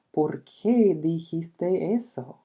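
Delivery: slow, clear Spanish model; slow Spanish translation, falling on qué. Shown as a demonstration. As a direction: stretch it out for the class. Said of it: "Por ejemplo".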